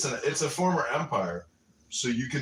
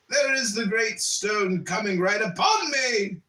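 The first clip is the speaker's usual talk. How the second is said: higher, loud panic; terrified cry; 8 semitones higher, 5 dB louder, slower than usual. Acted. Sleeper seeing the stone hit, terrified cry. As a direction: cry out as it lands. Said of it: "the sleeper".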